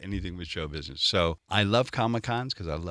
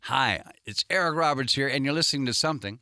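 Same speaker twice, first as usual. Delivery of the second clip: brisker, higher radio intro; station ID read, take two; 6 semitones higher than usual. Shown as a demonstration. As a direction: punch through the ID quickly and bright. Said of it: "Take two".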